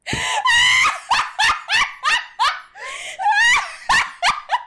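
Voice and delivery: shrieking, howling laughter; loud laughing fit. Real, not acted.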